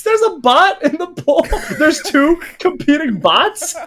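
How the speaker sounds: high voice breaking into laughter; laughing disbelief. Real, not acted. Host discovers competing bots in poll, laughing in disbelief.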